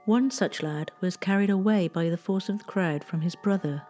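plain reading voice

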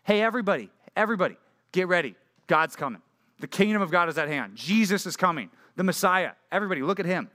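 usual speaking voice

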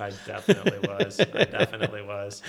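laughing through the words; chuckling while admitting it. Real, not acted. Laughing admission after a joke.

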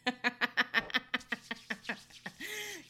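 long, drawn-out cackling witch laugh; evil witch laugh. Pretend. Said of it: "That's the evil witch laugh".

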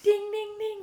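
high sung ding ding ding; playful bell call opening the bout; mock boxing bell. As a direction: ring the bell like a fight is starting.

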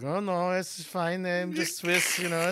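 lightly faked German accent, nervous denial. German newcomer reassuring suspicious neighbors, German accent. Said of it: "the accent's a little suspicious".

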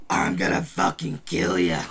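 voiced villain dropping low and loud; villain's threat. Villain threatening the player after her fall, low growl. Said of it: "she's just like".

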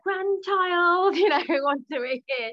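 hammed-up squeal over the new grandchild; mock gushing grandma. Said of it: "which I assumed she'd be like".

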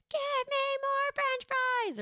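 very high, slow, stretched-out whine; whiny demanding toddler. Retold or imitated. Three-year-old at a restaurant demanding fries, high whine. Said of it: "they're just kind of like".

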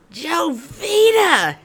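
a drawn-out furious shout of her name; angry yell. Retold or imitated. Angry boss shouting at her across the desk. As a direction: bellow the name across the office. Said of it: "he yelled at me".

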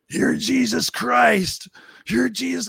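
imitating a drunk, high and slow; drunk guy. Drunk marine hailing the bearded teller as Jesus, high slow slur. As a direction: stretch it out, high-pitched and awestruck like a wasted guy. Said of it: "he's really drunk".

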